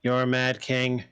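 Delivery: slow, level-pitched, deliberate judgement; translated character's verdict. Acted. Creature sizes up each visitor in turn, slow and even. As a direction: pronounce each judgement slowly and evenly.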